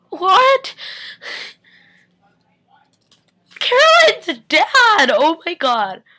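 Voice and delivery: high, loud, slow shocked cry; shocked outburst. Real, not acted. Fan reeling from the episode twist, shocked high cry. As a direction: gasp out the twist in disbelief.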